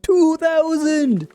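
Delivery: very high, drawn-out exclamation; thrilled high-pitched cry; excited squeal. Real, not acted. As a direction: squeal the milestone, stretching it out. Celebrating nearing subscriber milestone.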